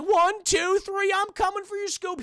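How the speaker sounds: high cartoon slacker counting down to a leap; Shaggy. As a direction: psych yourself up as the goofy sidekick. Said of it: "And he's like".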